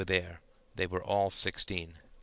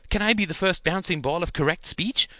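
much higher, louder eager plea; 8 semitones higher, 5 dB louder than usual. Acted. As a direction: beg for the turn excitedly. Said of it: "asked Gerald eagerly".

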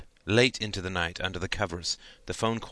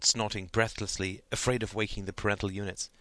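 ordinary delivery

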